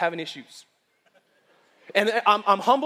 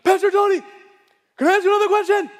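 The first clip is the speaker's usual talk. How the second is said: high, loud eager girl impression; mock squeaky eagerness; excited girl; 10 semitones higher, 6 dB louder, faster than usual. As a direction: call out like an overexcited girl. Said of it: "she gets so excited".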